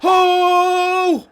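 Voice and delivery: high, long held "oh"; drawn-out cry. Real, not acted. Gamer reacting to the match, long high cry. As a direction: wail one sustained oh.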